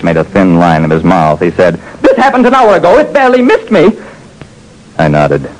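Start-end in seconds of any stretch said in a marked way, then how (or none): 2.0-3.9 high, tense voice; scared client speaking; frightened Frobish. Acted. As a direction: complain you were nearly shot.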